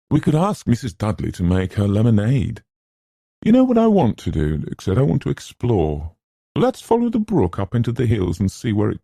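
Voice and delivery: high-pitched, eager suggestion; lively, lifted enthusiasm; eager proposal. Acted. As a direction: coax friends out to explore. Luke eagerly proposes an exploring trip.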